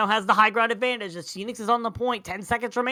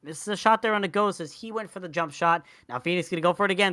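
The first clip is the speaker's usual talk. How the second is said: ordinary talk, nothing marked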